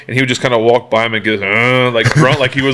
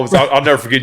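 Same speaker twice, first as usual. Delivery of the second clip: ordinary talk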